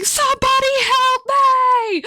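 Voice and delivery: high, loud, slow wail; wailing plea. Retold or imitated. Patient pleading for help, high loud wail. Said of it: "she starts going".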